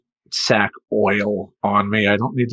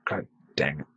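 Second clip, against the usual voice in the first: whispered under the breath; whispered curse; 7 semitones lower, 9 dB quieter than usual. Retold or imitated. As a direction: hiss it to yourself. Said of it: "I'm like".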